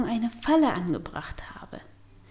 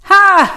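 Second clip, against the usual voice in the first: sudden loud high burst; loud startled ha; involuntary bark of a laugh; 10 semitones higher, 14 dB louder than usual. A narrator's reading. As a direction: blurt it out before you can stop. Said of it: "Entfährt es mir".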